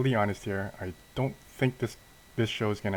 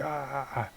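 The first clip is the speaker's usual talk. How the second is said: high, soft imitation scream; drawn-out pretend scream, held back; mock scream; 6 semitones higher, 5 dB quieter than usual. Hero blocking the energy beam, mock scream. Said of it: "he's, like, screaming".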